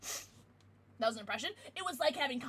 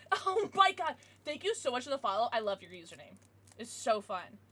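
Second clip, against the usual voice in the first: ordinary talk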